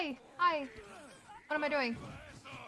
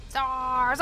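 ordinary talk, nothing marked

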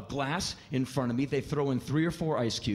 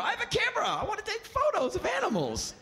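much higher, spelled-out explanation; high over-explaining. Retold or imitated. Comic shows the driver his camera, very high explaining. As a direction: explain it like to a child.

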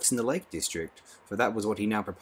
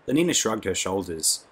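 ordinary delivery, nothing marked

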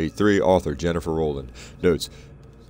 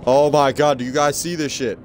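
higher, louder outburst of disbelief; shocked exclamation; 8 semitones higher, 7 dB louder than usual. Real, not acted. Player shows viewers a gross find, shocked outburst. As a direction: blurt it out to the chat in shock.

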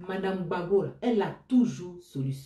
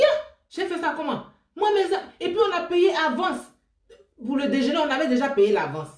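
high, distressed retelling of her tearful protest; 8 semitones higher, 3 dB louder than usual. Retold or imitated. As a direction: replay the tearful complaint, voice pitched up. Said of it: "J'ai dit, mes larmes coulent".

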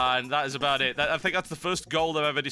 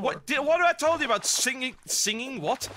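scolding parent voice; 10 semitones higher than usual. Acted. Scolding about singing sword.

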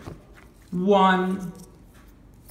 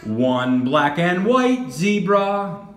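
slow teaching voice; slower than usual. Shown as a demonstration. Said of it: "teacher models phrase slowly".

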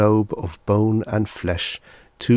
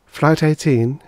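higher, blankly voiced stewardess; 8 semitones higher, 4 dB louder, slower than usual. A narrator's reading. Stewardess repeating the flight to a passenger, blank. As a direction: announce it blankly, raised pitch. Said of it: "she said blankly".

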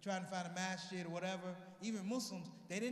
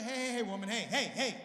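louder repeated hey to cut her off; loud hushing; 2 semitones higher, 8 dB louder than usual. Pretend. Husband cutting off his wife mid-argument, loudly. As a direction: shush her firmly with hey.

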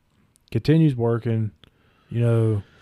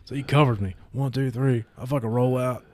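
usual speaking voice

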